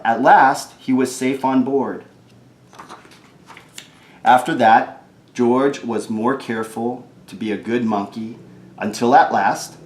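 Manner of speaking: ordinary delivery, nothing marked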